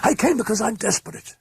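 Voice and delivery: high desperate blurt; blurted excitedly. A narrator's reading. Mother confessing desperation to the priest, excited blurt. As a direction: burst out with it. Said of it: "she blurted excitedly".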